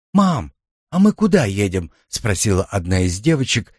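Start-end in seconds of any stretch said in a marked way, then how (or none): 0.1-1.9 higher, lightly voiced child; little girl's question. A narrator's reading. Girl in the back seat asks her mum, childlike. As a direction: pipe up like a curious daughter.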